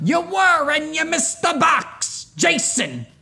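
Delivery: high, loud, slow call at a friend; excited yell. Real, not acted. Gamer yelling at Jason for missing the box. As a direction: shout the name across the room.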